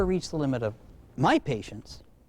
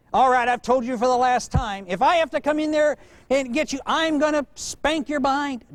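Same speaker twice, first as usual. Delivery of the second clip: mock threatening parent; 7 semitones higher than usual. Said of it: "don't threaten".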